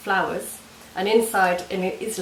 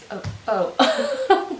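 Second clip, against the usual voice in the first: laughing; 10 semitones higher, 5 dB louder than usual. Real, not acted. Brownie nearly slips, she laughs.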